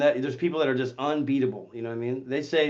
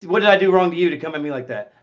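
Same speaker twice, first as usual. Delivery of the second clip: ordinary talk, nothing marked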